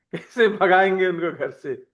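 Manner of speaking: laughing aside to the listeners; amused laughing. Real, not acted. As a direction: chuckle through the words. Amused aside on getting rid of Bhim. (हँसते हुए चुटकी लेना)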